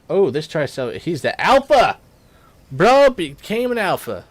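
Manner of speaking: high, loud hyped reaction; hyped-up gamer outburst; excited exclamation. Real, not acted. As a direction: cheer the new alpha on. Player reacts to dinosaur becoming alpha.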